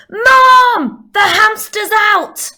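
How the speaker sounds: very loud, high, drawn-out yell; child's alarmed shout. Acted. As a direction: raise the alarm like a kid yelling downstairs. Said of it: "altered it slightly to do my kids' voices".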